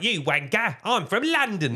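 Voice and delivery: mock London accent. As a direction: put on a hammy fake London accent. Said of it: "and doing an accent".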